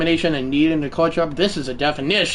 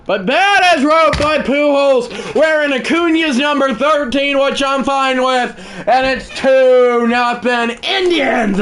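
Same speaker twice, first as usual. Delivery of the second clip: higher, slow, emphatic announcer call; play-by-play call; 8 semitones higher, 4 dB louder than usual. Acted. Gamer announcing his own home run, emphatic broadcaster call.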